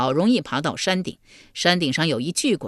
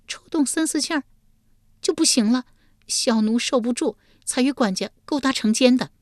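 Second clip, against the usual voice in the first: much higher, bashful woman's voice; bashful confession; 8 semitones higher than usual. Acted. Yulian shamefully explains her affair, high and bashful. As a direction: confess with embarrassment. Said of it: "玉莲满面羞容道".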